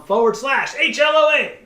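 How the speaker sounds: booming radio announcer read; big loud announcer delivery. Shown as a demonstration. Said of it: "Yes, I sound like my announcer voice".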